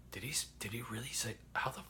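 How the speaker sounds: hushed whisper to himself, fast; whispered thought. Retold or imitated. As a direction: breathe the panicked thought under your breath. Panicked inner thought.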